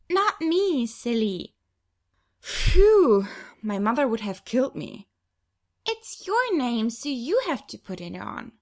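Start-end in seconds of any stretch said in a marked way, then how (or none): 0.1-1.5 put-on higher, playful child scolding; giggly little girl. Little sister teasing Angel, a giggly child. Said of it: "She giggles". 2.4-3.3 much higher, loud sigh of relief; loud relieved phew. Acted. Big sister relieved no tattoo, loud phew. Relief after checking arms. 5.9-8.5 put-on little girl informing. Little sister informing Angel, a matter-of-fact child. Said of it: "Paulina informs me".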